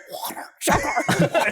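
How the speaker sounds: creaky alien demanding water, sugar; slow rasping alien voice; alien in human skin. Retold or imitated. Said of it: "the alien who gets the human skin".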